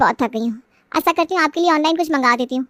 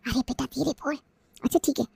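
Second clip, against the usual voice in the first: much softer, keep it down; hushed; 4 semitones lower, 11 dB quieter than usual. Acted. Mother on the phone hushing her daughter, very soft. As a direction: hush so nobody overhears.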